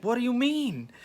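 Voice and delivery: high-pitched startled question; a panicked, squeaky what do you mean; alarmed disbelief. Retold or imitated. No epidural possible.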